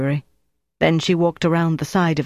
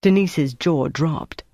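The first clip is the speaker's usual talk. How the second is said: ordinary delivery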